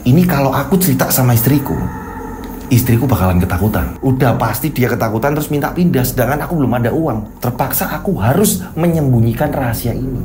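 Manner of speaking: Guntur's worried inner thoughts, low. A narrator's reading. Husband hiding a secret, low worried thoughts. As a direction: mutter it to yourself, low and brooding.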